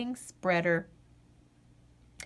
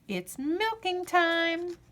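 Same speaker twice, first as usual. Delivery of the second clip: drawn-out singsong refrain; lilting storybook refrain; slow refrain; 8 semitones higher, slower than usual. A narrator's reading. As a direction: stretch it out like a chorus. Refrain read slowly.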